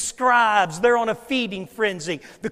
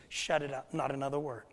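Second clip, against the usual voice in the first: low and much softer, a firm hush; quiet stern command; 7 semitones lower, 12 dB quieter than usual. Retold or imitated. Jesus silencing the storm, low and soft. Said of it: "He speaks to the storm exactly the way he speaks to demons".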